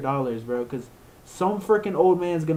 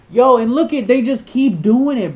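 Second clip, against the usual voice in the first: outraged outburst; 10 semitones higher, 11 dB louder than usual. Real, not acted. Outraged rant about AI misspellings.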